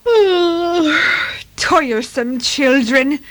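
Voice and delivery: high, loud yawn running into weary words; drawn-out yawning grumble. Acted. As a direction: yawn through the complaint. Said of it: "they agreed, yawning".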